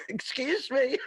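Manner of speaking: high, laughing apology; cracking up mid-line. Real, not acted. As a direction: still giggling as she apologizes. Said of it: "I'm laughing at my own joke here".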